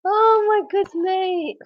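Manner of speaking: high squeal at the tail of a laughing fit; high, laughed-out exclamation; helpless laughter. Real, not acted.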